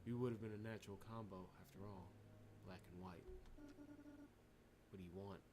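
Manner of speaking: soft, low, uneasy in-character reading; game protagonist voice. Acted. Player voicing the heroine exploring a frozen floor, soft and uneasy. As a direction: voice the wary hero exploring the floor.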